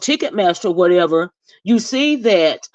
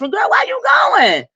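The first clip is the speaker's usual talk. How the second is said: loud high-pitched call-out; sassy high shout; 4 dB louder than usual. Pretend. Vlogger calling out the friend, loud high sass.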